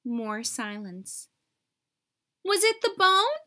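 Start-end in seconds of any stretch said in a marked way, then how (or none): 2.4-3.4 high, loud holler. A narrator's reading. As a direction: yell it up into the tree. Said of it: "Rocket hollered".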